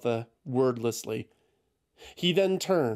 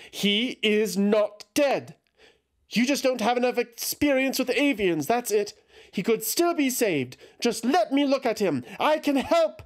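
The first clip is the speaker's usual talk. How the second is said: desperate denial; 11 semitones higher than usual. A narrator's reading. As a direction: refuse to believe it. Eothas denying his death.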